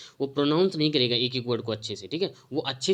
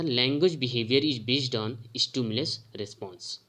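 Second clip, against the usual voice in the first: slow dictation; much slower than usual. Shown as a demonstration. Teacher dictating a quote.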